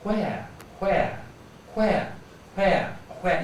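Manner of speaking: "where" with a puff of air at the start; airy, puffed "where"; blown wh demo. Shown as a demonstration. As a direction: blow on the wh of each "where". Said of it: "see if this piece of paper jumps".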